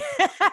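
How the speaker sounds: a short high laugh at herself; real laugh. Real, not acted. Host laughing about crying over the album.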